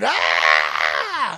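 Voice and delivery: long mock scream; retold scream. Nine-year-old running into the house bitten by ants, screaming. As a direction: scream it out like a panicking kid. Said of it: "I just start screaming".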